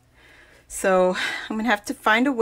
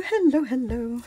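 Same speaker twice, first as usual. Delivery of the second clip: ordinary talk, nothing marked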